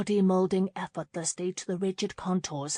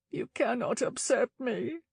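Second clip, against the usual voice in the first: sniffed; 5 semitones higher than usual. A narrator's reading. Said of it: "she sniffed".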